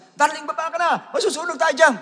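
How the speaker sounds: high-pitched mock panicky call; wife yelling up to her husband during the fire, panicky; panicked wife calling. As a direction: call up to him to come down. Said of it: "Sinasabi niya sa".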